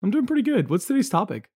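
bright, high host voice; chirpy customer service tone. Acted. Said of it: "I'm using my customer service voice".

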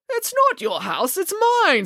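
high-pitched, drawn-out protest; indignant splutter. A narrator's reading. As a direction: splutter in outraged contradiction. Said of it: "spluttered Mister Green".

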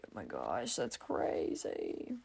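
low, near-whispered, drawn-out amazement; stunned mock awe. Answering the kid genius's barcode fact, hushed awe. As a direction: breathe it out slowly like your mind is blown.

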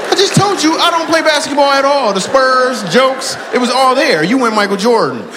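high-pitched comic tirade; mock outrage. Real, not acted. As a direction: scold him in exasperated, high-pitched protest. Comic scolding over mistaken name.

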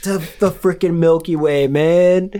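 high, loud whiny complaint; whiny alien grunt. Pretend. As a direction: moan like a doomed underling. Alien crew banter continues.

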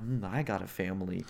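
low, soft retold realization; subdued mock reply. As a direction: drop down low and quiet, as if touched. Dad softens at shared situation.

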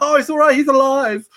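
high loud mock Korg; Korg impression. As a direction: chirp it out as a happy Korg. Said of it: "He's like".

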